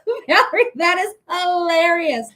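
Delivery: laughing hard, voice way up and cracking; real laughter spilling through. Real, not acted. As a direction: break into a laugh mid-word. Laughing at a viewer's comment.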